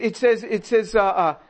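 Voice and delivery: ordinary delivery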